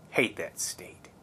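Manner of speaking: muttered whisper under the breath; whispered aside. Real, not acted. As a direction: hiss it as a secret jab. Aside grumble about Wisconsin.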